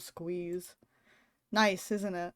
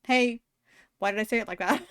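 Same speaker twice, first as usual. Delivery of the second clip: usual speaking voice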